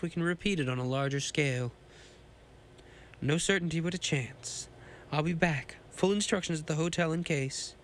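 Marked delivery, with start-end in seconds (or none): none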